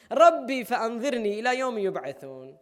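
melodic recited Arabic; Quranic recitation. Acted. Preacher quoting Iblis's plea in Quranic recitation. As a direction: recite it like scripture.

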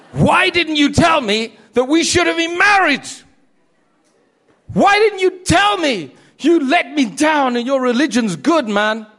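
a hammed-up shout of frustration; retold shout. Convert to his ex, shouting and pleading.

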